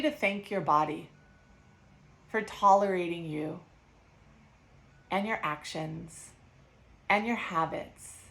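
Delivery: calm, unhurried guided breathing. Acted. Leads a breathing exercise.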